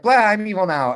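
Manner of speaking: mock dark-side declaration; mock evil Anakin. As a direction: sneer it like a cartoon bad guy.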